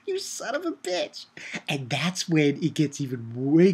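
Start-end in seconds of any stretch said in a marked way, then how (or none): 0.1-1.3 high, strained put-on voice, softer; mock outraged curse. Reacting to Vali's reveal, a high mock curse. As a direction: gasp it out in a squeaky mock outrage. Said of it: "I was like".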